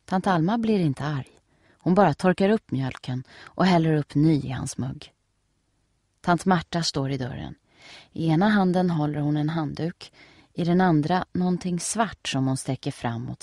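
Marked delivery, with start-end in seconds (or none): none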